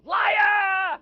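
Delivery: shrieking wife. Retold or imitated. Carol Kane's wife bursting in at Miracle Max, screaming. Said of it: "his wife, runs in and goes".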